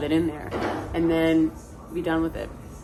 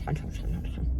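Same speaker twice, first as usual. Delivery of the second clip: raspy voiceless growl, much softer; the Grinch; 9 dB quieter than usual. Pretend. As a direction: rasp it out under the breath like a grouchy creature. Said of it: "that's my Grinch impression".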